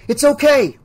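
reassuring call. Acted. Billy calls to Kate in the dark car, loud reassurance. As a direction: call out it's fine, he's safe.

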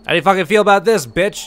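fast furious shout at her; angry yelling. Real, not acted. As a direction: snap at her, fast and loud. Yelling at flower-loving NPC.

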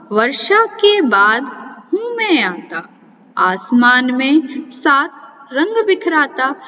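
put-on sing-song riddle recitation for children; reciting a riddle. Shown as a demonstration. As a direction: lilt the rhyme like a playful puzzle. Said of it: "अब हम कुछ पहेलियाँ पढ़ेंगे". (बच्चों को पहेली लय में सुनाना)

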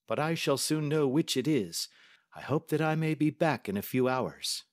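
a brisk, confident Holmes; the detective testing the banker, lightly voiced; Holmes, cool and measured. A narrator's reading. Holmes voiced while reading.

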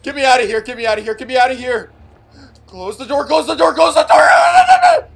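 frantic shouting; panicked yelling. Real, not acted. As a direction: yell to escape in panic. Fleeing monster, yelling.

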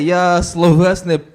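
normal talking voice

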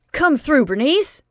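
high, loud eager urging. Acted. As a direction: egg her on. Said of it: "urged Otis".

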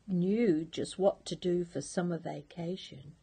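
ordinary delivery, nothing marked

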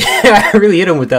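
high, loud, laughing through words. Real, not acted. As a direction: giggle while bragging. Laughing at own combo.

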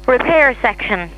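usual speaking voice

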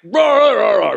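loud, high mock grumble of rahs; grumbling. Pastor mocks his own grumbling at family, loud grumble. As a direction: growl out the rahs like a grumpy dad.

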